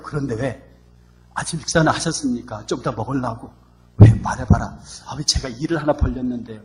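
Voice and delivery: soft, hushed mock-formal pleading; overly polite son. Son softly asking father for money, overly formal.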